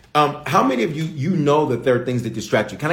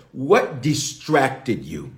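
what distracted you, slowly drawn out; slow, pointed question; much slower than usual. Shown as a demonstration. Preacher rephrasing the question, slow and deliberate. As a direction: let each word land slowly. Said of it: "say it another way".

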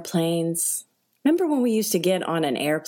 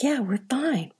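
hushed higher whisper of comfort; whispered reassurance; 6 semitones higher than usual. Retold or imitated. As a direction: whisper it to the neighbor. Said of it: "So I'm like".

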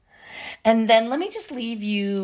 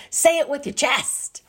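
high, punchy comic cry; Kevin Hart catchphrase; 6 semitones higher than usual. Acted. Host caps her pep talk, bold catchphrase cry. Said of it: "That's, like, a Kevin Hart thing".

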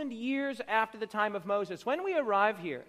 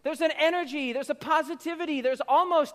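ordinary delivery

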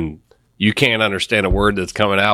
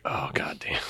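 hushed unvoiced aside; whispered mock dismay; whispered giggle; 5 semitones lower, 15 dB quieter, slower than usual. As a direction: breathe it out under your breath, amused. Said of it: "kinda giggle like".

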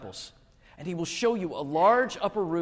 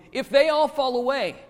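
loud, high, drawn-out declaration; impassioned vow; 10 semitones higher, 8 dB louder than usual. A narrator's reading. Peter vows loyalty to Jesus, loud and impassioned. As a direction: proclaim it loudly, stretching the words.

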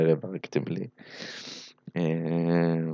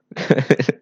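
laughing; 5 semitones higher, 4 dB louder than usual. Real, not acted. Laughs after Russian impression.

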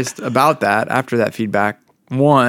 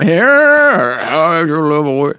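[name] impression; 3 dB louder, much slower than usual. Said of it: "[name] version".

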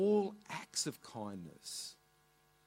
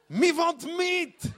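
much higher, louder, slow laughing demand; emphatic broken English; 14 semitones higher, 9 dB louder, much slower than usual. Retold or imitated. Mum pressing the butcher, much higher and louder. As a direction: draw it out with a laugh.

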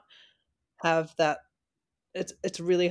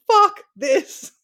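high, loud laughing curse; exasperated outburst; 10 dB louder than usual. Retold or imitated. Co-host recounting her bad scan results, exasperated outburst. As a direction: blurt it out in comic exasperation.

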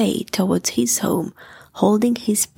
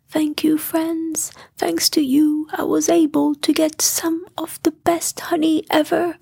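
grateful Pooh; 6 semitones higher than usual. Acted. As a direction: thank your friends from the heart. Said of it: "said Pooh, very grateful".

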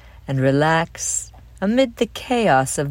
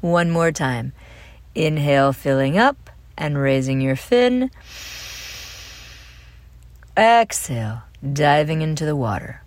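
guided breathing. Acted. Host leads children through dolphin breathing, calm guided meditation. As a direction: lead each breath calmly, leaving room to breathe.

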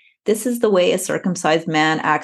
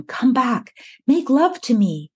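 higher-pitched mock seductress quote; beckoning lover; 6 semitones higher, slower than usual. Woman in the text calls her lover back, acted beckoning. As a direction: beckon him back in a raised voice.

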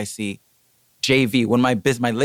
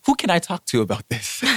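retold firm inquiry, pitch raised; polite but firm; 6 semitones higher than usual. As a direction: ask pointedly yet cordially. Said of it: "but we're being firm".

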